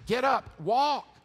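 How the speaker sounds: loud, high, forceful command; commanding shout. Acted. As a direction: order a lame man onto his feet. Peter commands paralytic man to walk.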